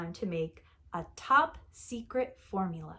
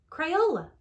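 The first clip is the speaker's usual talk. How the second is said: Crayola said higher and louder, with a proud falling finish; excited repeat; 7 semitones higher, 5 dB louder than usual. Acted. Alice announces the name, repeating it with excitement. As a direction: proclaim the name the second time with delight. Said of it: "She said".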